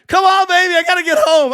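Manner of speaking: impatient urging. Retold or imitated. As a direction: urge yourself on, itching to get home to the game. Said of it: "I'm just like".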